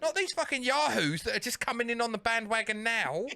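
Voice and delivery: high-pitched indignant customer tirade; hammed-up fed-up caller, voice pushed up; mock outraged rant. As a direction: rant in comic exasperation. Retold angry rant at phone company.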